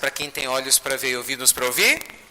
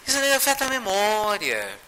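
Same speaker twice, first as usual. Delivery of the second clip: embarrassed; 6 semitones higher than usual. Retold or imitated. Chico failing to recall her name, embarrassed excuse. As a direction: excuse yourself awkwardly, dragging the last word. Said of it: "Aí ele todo sem jeito".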